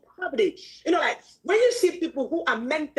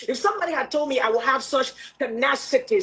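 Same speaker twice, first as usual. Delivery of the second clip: ordinary talk, nothing marked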